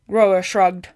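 ordinary delivery

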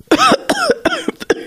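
harsh hacking coughs after a cackle; hacking cough fit. A narrator's reading. Brady coughs hard after laughing at the warlock, hacking. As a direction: cough it out, choking on smoke. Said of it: "It degenerates into a hacking fit".

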